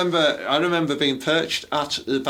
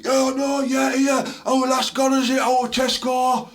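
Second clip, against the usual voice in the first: mock lad voice; 12 semitones higher than usual. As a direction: ham up a boozy lad on a night out. Mocking lads on Christmas night.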